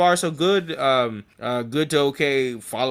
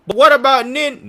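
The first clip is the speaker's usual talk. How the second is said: high plaintive question; 11 semitones higher, 8 dB louder than usual. Retold or imitated. Background voice in credits.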